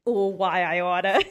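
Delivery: slow loud mock threat; loud why I oughta; old-timey threat. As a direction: forties tough guy bluster. Repeats forties catchphrase.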